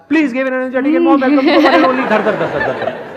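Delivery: slow, laughing mock announcer intro; host announcement. Shown as a demonstration. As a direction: rattle off the crammed welcome like a stage host. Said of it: "जैसे मैं नहीं बोलता यहाँ पे". (रटी हुई हंसते हुए उद्घोषक वाली घोषणा)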